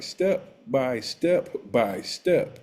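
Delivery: "step by step by step" very slow, each step set apart; step-by-step emphasis. Shown as a demonstration. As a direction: spell out the order, pausing through "step by step by step". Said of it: "when I say I laid it out in order".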